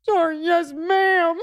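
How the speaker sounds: put-on high-pitched blubbering child; mock crying. Little brother wrongly answering dad, mock crying. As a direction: imitate a confused, tearful little boy. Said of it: "he was crying".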